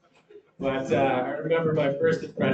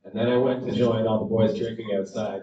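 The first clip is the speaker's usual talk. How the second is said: usual speaking voice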